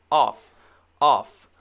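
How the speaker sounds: "off" loud, ending in voiceless F; punched "off", no voice at the end; clear F sound. Shown as a demonstration. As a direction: drill "off" with breath-only F. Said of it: "very clearly the f sound, no voice".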